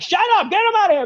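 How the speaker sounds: high, loud, fast shout; quick squawking yell to throw someone out; angry heckler. Acted. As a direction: heckle from the crowd in a put-on voice. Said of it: "someone's like".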